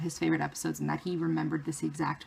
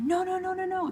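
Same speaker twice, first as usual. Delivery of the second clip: much higher, rapid panicked no's; frantic protest; 12 semitones higher, 4 dB louder than usual. Retold or imitated. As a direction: stop him in a rising panic. Friend panics at huge letters.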